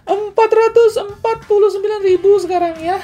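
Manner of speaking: hammed-up, very high-pitched price call; exaggerated squeal. Reviewer jokingly blurting the price to viewers, exaggerated high squeal.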